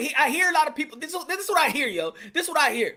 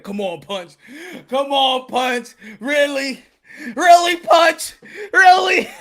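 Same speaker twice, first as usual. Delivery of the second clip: whiny complaining fans; 10 dB louder, much slower than usual. Retold or imitated. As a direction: plead with him in a drawn-out mock sob. Imitating people complaining to him.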